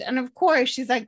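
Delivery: ordinary talk, nothing marked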